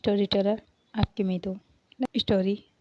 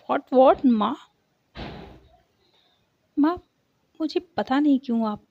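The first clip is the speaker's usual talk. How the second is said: louder and higher, startled outburst; shocked disbelief; 5 semitones higher, 7 dB louder than usual. A narrator's reading. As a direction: blurt it out in shock at her mother. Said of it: "Sarika वो shocked". (चौंककर ऊँची, तेज़ आवाज़ में)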